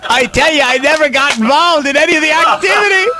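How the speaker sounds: high shouted denial. Acted. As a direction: protest innocence at full voice. Comic protest of innocence.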